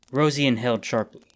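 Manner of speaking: plain reading voice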